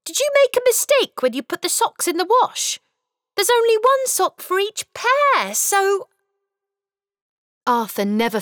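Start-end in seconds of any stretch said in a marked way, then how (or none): 0.0-6.1 put-on high, quick child voice, puzzled; a boy confused by missing socks; puzzled little boy. Boy questioning his dad about the socks, puzzled.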